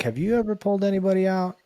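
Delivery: quick, high-pitched quoted question; light, fast, high mock inquiry; retold curious question. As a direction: voice jumps up high to ask, imitating yourself. Retold question put to her.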